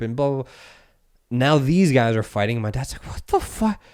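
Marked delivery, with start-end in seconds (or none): 3.1-3.8 high, stunned what the fuck; imitating a baffled dad; shocked disbelief. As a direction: squeak it out in disbelief. Dad shocked at fighting Taliban.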